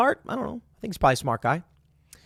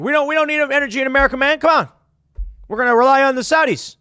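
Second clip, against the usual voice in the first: mock old politician, fast, higher and louder; Joe Biden impression; 9 semitones higher, 6 dB louder, faster than usual. President brushing off energy needs, hammed-up mock voice. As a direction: rattle it off like a careless president. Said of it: "Joe Biden comes in and he's like".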